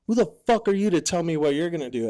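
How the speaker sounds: fast, high, loud indignant snap; fast angry retort. Retold or imitated. Drill instructor scolding a defiant recruit, fast angry snap. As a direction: cut him down fast like an angry DI.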